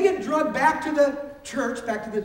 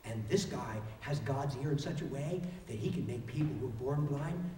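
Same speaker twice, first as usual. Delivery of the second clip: soft sly sarcasm; 12 semitones lower, 13 dB quieter than usual. Acted. Healed man calmly mocks the leaders.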